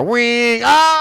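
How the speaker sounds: high, loud mock siren wail then a yelp. Caught by the police spotlight, a high loud siren yelp. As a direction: blare it like a cop light, then a yelp. Cop spotlight hits him.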